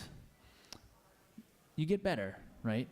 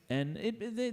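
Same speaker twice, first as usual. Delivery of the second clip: normal talking voice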